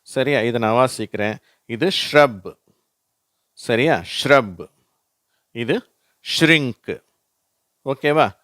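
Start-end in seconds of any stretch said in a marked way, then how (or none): 1.9-2.6 "ஷ்ரப்" with sh and r run together; teacher reading out "ஷ்ரப்"; merged SHR. Shown as a demonstration. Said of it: "எல்லாத்தையும் சேர்த்து அப்படியே merge பண்ணி". 4.2-7.0 "ஷ்ரப்" and "ஷ்ரிங்க்" with sh glued to r; merged SHR. Shown as a demonstration. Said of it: "எல்லாத்தையும் சேர்த்து அப்படியே merge பண்ணி".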